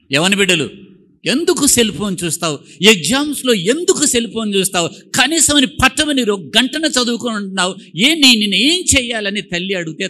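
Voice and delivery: loud, high rebuke; scolding. Acted. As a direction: scold the young ones hard. Scolding youths over phones. (గట్టిగా మందలింపు)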